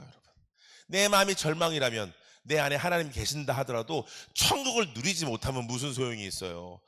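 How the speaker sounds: usual speaking voice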